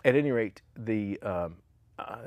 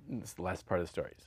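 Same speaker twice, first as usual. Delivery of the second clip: ordinary talk, nothing marked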